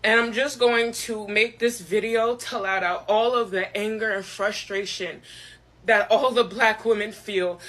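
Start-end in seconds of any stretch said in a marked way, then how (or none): 0.0-2.4 crying. Real, not acted. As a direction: speak through frustrated tears. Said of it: "I'm crying".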